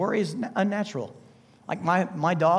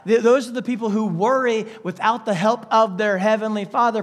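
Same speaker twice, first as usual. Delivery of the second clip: ordinary talk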